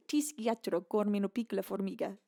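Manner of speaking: careful learner's Italian accent; Italian sentence. Shown as a demonstration. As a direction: say it in your best attempt at Italian. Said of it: "so I can say that in Italian".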